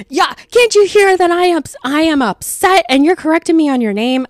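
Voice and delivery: high, loud indignant outburst; imagined upset caller. Pretend. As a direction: snap back like a hurt, offended caller. Imagined upset caller snapping back.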